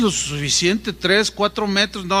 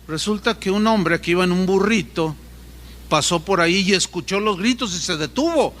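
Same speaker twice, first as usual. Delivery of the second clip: ordinary talk, nothing marked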